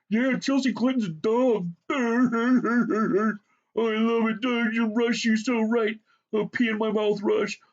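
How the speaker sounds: high, loud mocking imitation of braying sycophants; hammed-up stupid guffaws, words squealed through the laughter; guffawing idiot fans.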